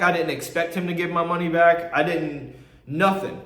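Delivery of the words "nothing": usual speaking voice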